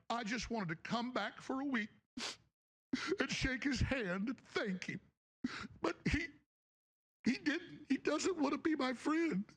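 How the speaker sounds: high, whining mock of a wounded friend; whiny mock victim. Mocking his rival's on-air pity plea in a high whiny voice. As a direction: pitch it up like a sulking kid.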